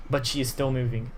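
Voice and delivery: ordinary talk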